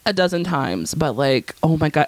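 ordinary talk

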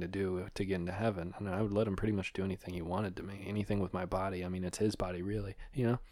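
hushed, low coaching; feed someone lines under your breath; very soft, low murmur; whispering off mic. Real, not acted. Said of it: "I'm whispering".

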